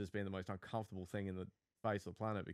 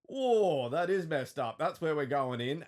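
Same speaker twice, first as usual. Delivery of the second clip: doctor's surprised quote; 6 semitones higher, 7 dB louder, slower than usual. Retold or imitated. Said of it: "he's like".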